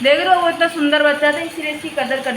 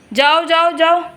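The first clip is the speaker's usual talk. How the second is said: "जाओ, जाओ" called out louder and higher; loud shooing call; 4 semitones higher, 4 dB louder, slower than usual. Real, not acted. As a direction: shoo the cow off with a raised voice. मैंने उसको बड़े प्यार से बोला. (गाय को ज़ोर से "जाओ, जाओ" कहना)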